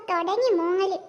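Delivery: ordinary talk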